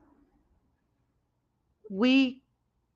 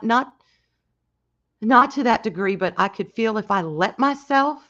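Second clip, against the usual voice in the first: normal talking voice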